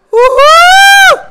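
high excited whoop; cheering. Real, not acted. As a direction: whoop it out loud. Cheering in game.

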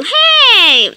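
ordinary delivery

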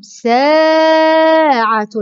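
ساعة very slow, with a long saa; stretched example word. Shown as a demonstration. Teacher models seen at word start, slow and stretched. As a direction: hold the aa of ساعة. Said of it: "سين جاءت في أول الكلمة".

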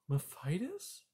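soft, high, rising question; taken aback. A narrator's reading. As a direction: query it in hushed surprise. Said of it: "cried Poppy, taken aback".

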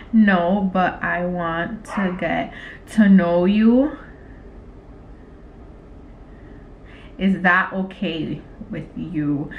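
slow, low, word-by-word; typing aloud. Real, not acted. Typing a flirty text to him, slow dictation. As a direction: say it slowly as you type it out.

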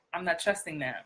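low, soft, distrustful aside; wary aside. Acted. Wary listener reacting to someone's odd manner, distrustful aside. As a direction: drop down low and quiet, suspicious. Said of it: "you would be like".

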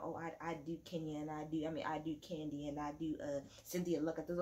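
lightly mimicked, low and soft quote; offhand low mock quote; mocking imitation. Mimics someone's flirty table talk.

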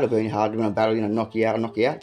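ordinary delivery

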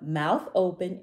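plain reading voice

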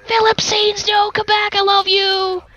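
high, mock-plaintive calling; playfully pleading, high-pitched. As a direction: call after them in a high voice. Calls after departing rats.

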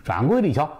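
ordinary delivery, nothing marked

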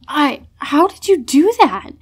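stunned. A narrator's reading. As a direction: blurt it out in shock.

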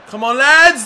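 loud exasperated urging; frustrated shout. Real, not acted. Gamer yelling at his FIFA side after a mistake, loud. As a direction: yell at the screen to rally your team.

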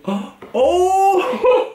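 high hooted "oh" breaking into laughter; gasp, then howl with laughter. Real, not acted. Reacting to a post, high delighted hoot and laugh.

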